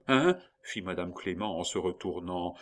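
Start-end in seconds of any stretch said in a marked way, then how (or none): 0.1-0.4 loud, high, startled question; jolted into a sharp question; sudden high-pitched what; startled query. A narrator's reading. Startled nurse turns round at cry.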